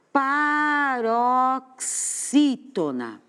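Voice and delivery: paroxítona drawn out very slowly, syllable by syllable; stress demo. Shown as a demonstration. Teacher spelling out a term for fifth graders, very slow. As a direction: stretch every syllable of paroxítona so the class hears the stress. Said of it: "aqui eu vou colocar".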